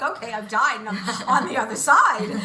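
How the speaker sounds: giddy, bursting into laughter mid-line; laughing quote. Retold or imitated. Retelling her dream of heaven, laughing loudly and giddily. Gleefully quoting herself in heaven.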